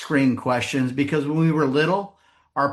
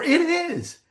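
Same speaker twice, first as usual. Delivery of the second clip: very high-pitched mock enthusiasm; exaggerated delighted agreement; eager agreement; 13 semitones higher than usual. Imagined driver agrees eagerly.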